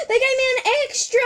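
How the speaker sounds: high, loud, fast squealing cry; delighted squeal. Real, not acted. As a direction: squeal with joy at the surprise gift. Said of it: "Sorry if I squeal a lot".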